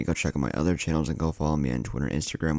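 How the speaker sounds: ordinary delivery, nothing marked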